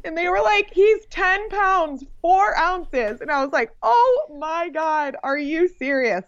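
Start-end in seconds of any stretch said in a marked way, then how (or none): 0.7-3.2 imitated staff, high and drawn-out; amazed announcement. Nurses telling the mother her baby's weight, amazed announcement. 3.8-6.2 high, stretched-out retold shock; stunned disbelief. Mother hearing her baby weighs ten pounds, stunned disbelief.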